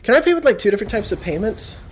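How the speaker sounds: high, mocking put-on voice of the shopper; hammed-up high imitation of a clueless customer. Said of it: "And then she goes".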